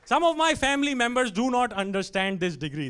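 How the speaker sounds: ordinary delivery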